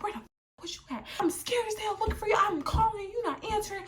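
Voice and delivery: high-pitched mock scolding; acted frantic mom. Mom bursts into her daughter's room, acted frantic scolding. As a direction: imitate mom's worried, angry tirade.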